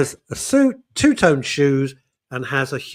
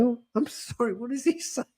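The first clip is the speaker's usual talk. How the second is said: high, soft, confused tourist voice; a polite bewildered visitor, high and soft; puzzled tourists; 9 semitones higher, 8 dB quieter than usual. Retold or imitated. Tourists asking what Rocking Dave said, high puzzled voice.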